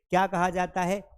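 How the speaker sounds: ordinary talk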